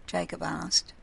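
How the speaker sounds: ordinary delivery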